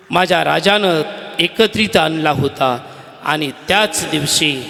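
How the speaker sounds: ordinary talk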